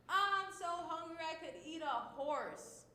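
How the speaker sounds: I'm so hungry pitched high and loud, overplayed; super dramatic. Shown as a demonstration. Teacher acting out a hyperbole, hammed-up dramatic. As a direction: ham up the hunger like a starving kid. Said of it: "That's a dramatic statement".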